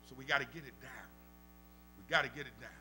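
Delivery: ordinary delivery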